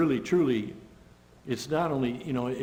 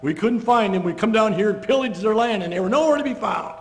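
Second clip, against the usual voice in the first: higher, louder jeering taunt; mocking; 5 semitones higher, 5 dB louder than usual. Philistines taunting the Hebrews as rats, loud and mocking. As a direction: sneer at the hiding rats. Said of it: "They're almost mocking them".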